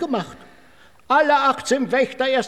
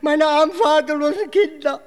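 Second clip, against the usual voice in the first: higher, slow theatrical wailing; mock lament; 7 semitones higher than usual. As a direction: wail over your poor family, overdone. Thief laments his family. (übertrieben jammernd, hoch und langsam)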